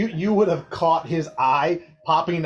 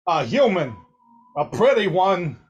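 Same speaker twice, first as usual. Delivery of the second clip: louder, higher flirty alien admirer; Doc flirting; 4 semitones higher, 6 dB louder than usual. Acted. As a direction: charm the pretty human. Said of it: "with Doc going".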